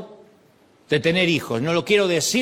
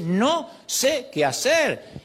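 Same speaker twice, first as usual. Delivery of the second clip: very slow, higher, each word drawn out; slow humble prayer; 8 semitones higher, much slower than usual. Shown as a demonstration. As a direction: lay the words down slowly, helpless. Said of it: "hay que decir". (oración humilde, muy lenta y aguda)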